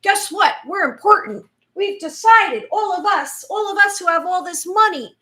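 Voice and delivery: high, loud self-important announcement; mock New World Order. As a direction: announce it like smug powerful elites. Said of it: "you know, they've been like".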